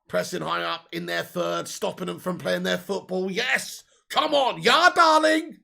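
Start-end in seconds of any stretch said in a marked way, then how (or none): 3.3-5.6 excited cheer. Real, not acted. Celebrating, slipping into German voice.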